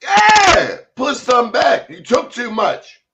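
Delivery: high, loud scolding; scolding shout. Retold or imitated. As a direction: bark the order at her. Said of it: "she said".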